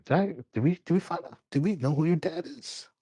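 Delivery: high-pitched in-character question; halting, lightly acted query pitched well up; [name]. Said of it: "[name] asked Cassidy".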